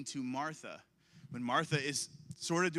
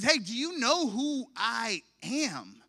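loud, high, slow call from above; booming God; 9 semitones higher, 9 dB louder, slower than usual. Acted. God calling out to stop Saul, booming. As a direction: stop him cold with a divine shout. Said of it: "God just says".

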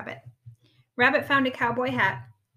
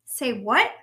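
surprised; 8 dB louder than usual. Acted. Rabbit surprised.

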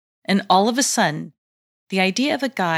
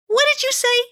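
high, shocked question; 4 dB louder, faster than usual. Acted. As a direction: ask in sudden shock. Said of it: "she asked in shock".

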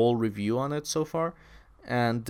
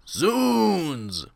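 imitating a weaker, falling shout; half-hearted drawn-out yell, raised only moderately; underpowered dub yell; 7 semitones higher, 4 dB louder than usual. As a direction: call it out long but hold back the force. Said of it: "That doesn't convey the same comedic effect".

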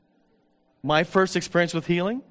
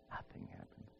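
hushed whispered letdown; 25 dB quieter than usual. Real, not acted. Preacher admitting his fasting failed, whispered. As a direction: drop to a whisper, deflated.

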